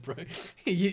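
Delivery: ordinary delivery, nothing marked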